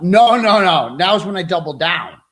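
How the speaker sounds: loud, defiant no, no, no; defiant shout. Real, not acted. Streamer defying the lawyers suing him, loud and defiant. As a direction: shout them down and double down. Defiant vow against lawsuit plaintiffs.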